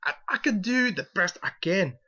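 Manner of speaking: a fan's hammed-up Scottish Scrooge brogue; playful attempt at a Scottish accent; mock Scottish accent. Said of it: "I'll work on my Scottish accent".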